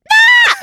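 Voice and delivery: very high, loud squealing ah; thrilled high scream; excited squeal. Retold or imitated. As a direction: squeal with delight. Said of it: "I almost was like".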